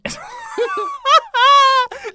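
high cracking laugh at her own attempt; burst of laughter. Real, not acted. Laughing at a failed girl voice. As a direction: crack up mid-bit. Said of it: "No, wait, wait".

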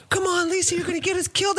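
half-acted panicked plea from a friend; retold yell. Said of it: "Fab's yelling at me".

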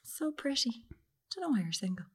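hushed, sneering quote of the compliment; whispered mock compliment. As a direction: breathe it out like a snide aside. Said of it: "someone just gone".

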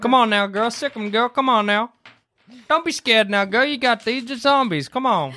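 encouraging a dog. Acted. Urging dog into fight.

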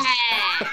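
"care" stretched long and loud; London "cow" as a loud, long "care"; long vowel. Shown as a demonstration. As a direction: hit "care" loudly with a drawn-out vowel. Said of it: "Your vowels get really long".

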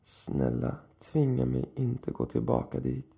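plain reading voice

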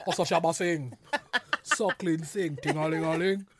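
rapid-fire dancehall toasting, then a low chant; playful mock reggae deejay patter. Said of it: "your particular impression".

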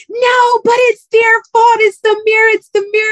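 put-on high, loud, protesting little-girl voice; a little girl shouting that it's not her fault; whiny little girl. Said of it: "It's like this little baby girl who's like".

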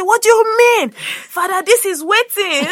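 high, loud protest that stretches out at the end; retold indignation. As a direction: plead in mock outrage with a priest. Father's outraged protest, retold.

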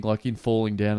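ordinary talk

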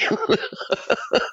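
breathy high little laugh; soft laugh. Real, not acted. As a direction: laugh it off lightly. Laughs at own remark.

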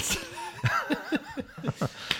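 a burst of squealing laughter; high squealing laugh. Real, not acted. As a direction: let out a high giggling shriek. Big laugh at own story.

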